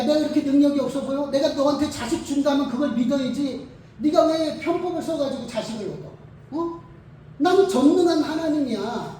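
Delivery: loud, indignant rebuke; God rebuking Abraham for not trusting, loud and sharp; God scolding. Retold or imitated. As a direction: tell him off like an offended almighty.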